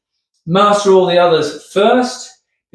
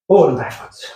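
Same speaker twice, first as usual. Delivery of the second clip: "Forward and backwards" puffed out while hopping, lower; breathless mid-hop; 3 semitones lower than usual. Real, not acted. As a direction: gasp the words out between hops. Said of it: "I'm getting out of breath".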